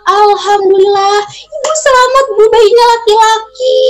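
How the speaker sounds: high happy news; joyful announcement. Acted. Midwife tells the mother her baby boy is born, joyful. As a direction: announce the healthy boy with joy.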